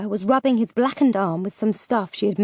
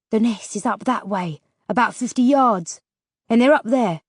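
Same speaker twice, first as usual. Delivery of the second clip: plain reading voice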